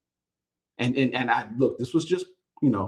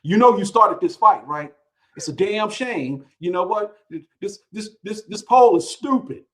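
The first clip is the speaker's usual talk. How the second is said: mock-angry fan email, read out; imitating irate fans blaming him; 5 semitones higher, 5 dB louder than usual. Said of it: "getting email, most of them from Southern folks".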